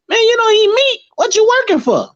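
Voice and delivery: high put-on foreign voice; imitating the guy. High imitation of the guy scoffing at vegetarians. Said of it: "one dude gonna tell me".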